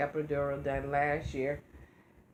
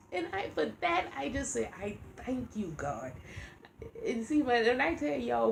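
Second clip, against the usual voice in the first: holding back tears; 5 semitones higher than usual. Real, not acted. Thanking God for the license money, holding back tears. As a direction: fight back tears while giving thanks. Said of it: "I try not to cry now because I get emotional".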